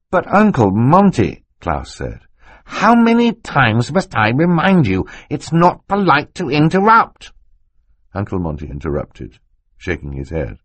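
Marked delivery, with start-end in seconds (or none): 0.1-1.5 high, slow protest; Klaus pleading. A narrator's reading. Klaus interjecting. 2.6-7.3 higher, slow teasing scold; a mild playful scolding; mock scolding uncle. A narrator's reading. Monty scolds while interrupting.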